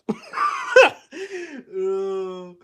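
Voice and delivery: high, loud laugh; a bright burst of laughter after a joke. Real, not acted. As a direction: laugh it off loudly.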